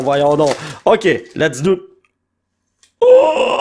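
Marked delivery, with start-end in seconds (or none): 3.0-3.6 high, drawn-out "oh" of amazement; sudden high squeal; excited cry. Real, not acted. Player sees the card he hoped for, high amazed cry.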